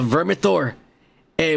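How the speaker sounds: usual speaking voice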